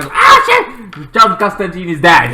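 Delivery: panicked outburst. Retold or imitated. Charlie finding Constantine dead, loud alarmed cry. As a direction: blurt it out in shock.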